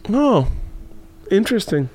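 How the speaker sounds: lightly imitated unbothered mother; oblivious mom. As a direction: take in bad news with polite vagueness. Oblivious mom's mild reply.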